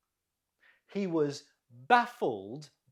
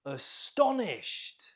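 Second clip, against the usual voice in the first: Astonished, slow, with STON raised and stretched; stress on second syllable; 4 semitones higher, slower than usual. Shown as a demonstration. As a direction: punch STON in Astonished, slowly. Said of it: "Note the stress on the second syllable".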